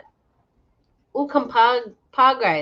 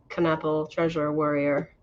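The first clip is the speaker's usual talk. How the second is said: ordinary talk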